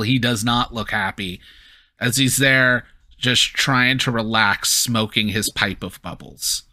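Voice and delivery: ordinary delivery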